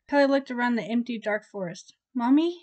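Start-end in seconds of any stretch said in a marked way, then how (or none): 2.1-2.6 put-on higher, louder little child's call; lost boy calls for his mother; child crying out. A narrator's reading. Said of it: "he cried".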